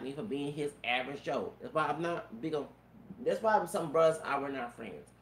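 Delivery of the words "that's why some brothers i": ordinary talk, nothing marked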